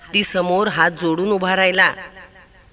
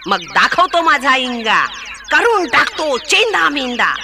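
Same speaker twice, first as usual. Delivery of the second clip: elephant yelling; 7 semitones higher than usual. Acted. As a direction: roar at them to come out. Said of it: "हत्ती ओरडला".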